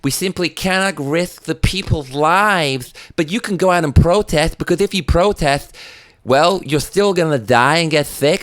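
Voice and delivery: mock the mayor's hypocrisy; hammed-up sneering politician. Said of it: "said the mayor".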